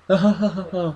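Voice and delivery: high, pulsing mock laughter; put-on cackle from a movie scene; imitated maniacal laugh. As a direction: imitate the laughing moose, ha after ha. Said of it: "like, laughing".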